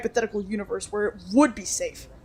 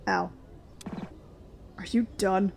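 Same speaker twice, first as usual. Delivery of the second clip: ordinary delivery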